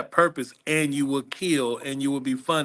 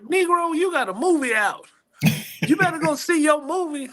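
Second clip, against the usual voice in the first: mock-bossy woman's voice, pitched way up; CEO's scolding voice; 13 semitones higher than usual. CEO scolding him from the couch, high bossy voice. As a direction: boss her friend into getting off the couch. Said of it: "She was like".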